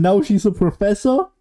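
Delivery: ordinary talk, nothing marked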